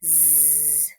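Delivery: soft low zzz; sleepy buzz. Shown as a demonstration. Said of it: "And he says".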